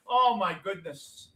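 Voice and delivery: ordinary talk, nothing marked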